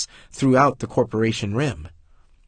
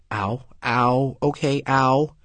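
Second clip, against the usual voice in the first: pained ow; 2 semitones higher, slower than usual. Real, not acted. Pain from shrapnel hits.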